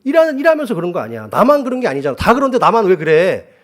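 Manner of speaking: high, loud indignant protest; arguing back. Retold or imitated. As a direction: re-enact a heated argument with your wife, voice climbing high. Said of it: "제가 막 따졌어요".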